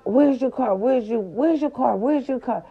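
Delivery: high, repeated frantic questioning. Retold or imitated. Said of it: "I'm frantic".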